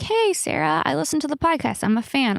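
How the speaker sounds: sweet fan saying hello; mock fan approaching her; imitating a friendly fan. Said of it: "you come up to me and you're like".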